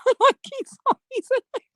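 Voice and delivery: high, squeaky mimicry of the captain, laughing; mocking Captain. Mimicking Captain praising Esau.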